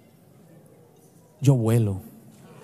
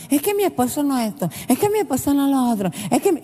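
mock complaining wife; 5 semitones higher than usual. Wives complaining about husbands.

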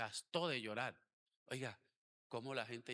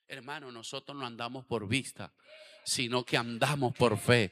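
fiery preaching; 18 dB louder than usual. Acted. Pastor reads Jairo's story to the congregation, preaching loudly. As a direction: proclaim it to the whole church.

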